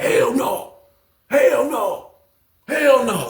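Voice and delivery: high repeated no; emphatic denial. Retold or imitated. OG denying the accusation, high voice. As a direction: deny it flatly three times.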